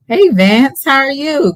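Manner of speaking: excited greeting. Real, not acted. Greeting a viewer joining live.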